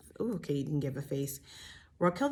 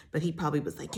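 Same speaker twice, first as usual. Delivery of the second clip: ordinary talk, nothing marked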